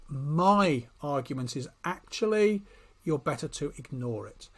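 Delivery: normal talking voice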